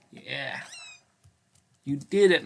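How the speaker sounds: ordinary talk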